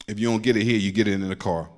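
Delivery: normal talking voice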